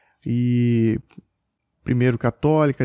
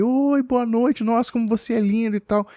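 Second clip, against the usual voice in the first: put-on cooing at a baby; 9 semitones higher than usual. Men greeting the boy warmly.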